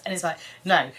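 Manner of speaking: ordinary talk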